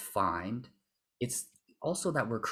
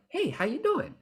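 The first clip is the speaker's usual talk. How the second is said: much higher, lively "Hey, how you doing?"; high bright greeting. Shown as a demonstration. Coach experimenting with a high version of the greeting. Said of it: "we experiment with our voice and be like".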